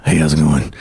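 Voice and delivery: low hormone monster voice; deep, low character greeting; super deep voice. Acted. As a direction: drop it way down for a cartoon monster. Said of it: "it's a super deep voice".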